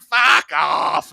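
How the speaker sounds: irritated mock shout. Rock star swats away a needling onlooker, irritated mock shout. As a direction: shout it as a brush-off, imitating an annoyed star. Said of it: "And he's like".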